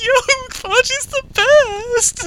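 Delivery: high, slow, starry-eyed praise; gushing fan. Retold or imitated. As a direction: swoon over Baji, drawn out. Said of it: "I'm just like".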